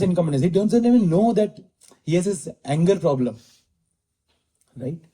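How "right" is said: ordinary talk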